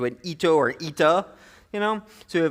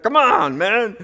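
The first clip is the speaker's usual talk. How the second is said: exasperated; 5 semitones higher, 7 dB louder, slower than usual. Retold or imitated. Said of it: "You're like".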